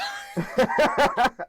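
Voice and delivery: a high, loud burst of real laughter; big laugh. Real, not acted. Laughing hard at dad's old insult, a big loud laugh. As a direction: crack up at the punchline.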